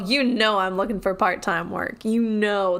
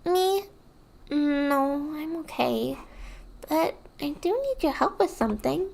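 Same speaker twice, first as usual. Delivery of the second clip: put-on high, sweet kid voice; childish voice; 6 semitones higher than usual. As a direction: speak like a small child. Streamer voicing child NPC dialogue.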